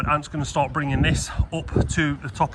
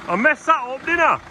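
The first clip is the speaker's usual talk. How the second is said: excited; 12 semitones higher than usual. Real, not acted. Laughing at own mistake.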